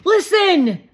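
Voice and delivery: high, loud excited cry; excited call. A narrator's reading. Shake hearing the ice cream truck, loud excited call. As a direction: call out, thrilled.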